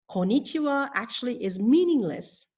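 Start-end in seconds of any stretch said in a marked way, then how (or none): 0.1-0.9 "Konnichiwa" as ko-ni-chi-wa, only four syllables; missing first N. Shown as a demonstration. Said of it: "it is missing the first N".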